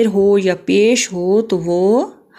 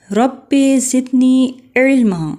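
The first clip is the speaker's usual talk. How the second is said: slow, higher recited verse; Quranic recitation; 4 semitones higher, slower than usual. Shown as a demonstration. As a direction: draw the verse out melodically. (آہستہ، ترنم سے تلاوت)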